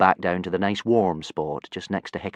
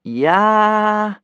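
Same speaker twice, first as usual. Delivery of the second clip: half-hearted war cry; 13 semitones higher, 9 dB louder than usual. Acted. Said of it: "without much enthusiasm".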